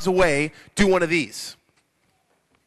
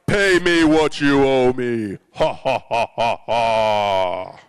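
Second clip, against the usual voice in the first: slow, lower, loud royal demand with a pompous laugh; booming king; 7 semitones lower, 5 dB louder, much slower than usual. Acted. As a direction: boom it out like a gloating king. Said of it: "the king needs a booming voice".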